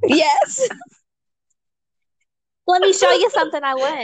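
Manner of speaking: put-on high, quick Harley Quinn accent; eager, high-pitched Harley Quinn accent. Showing off pole dancing as Harley Quinn, high accent. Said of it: "You should do Harley Quinn".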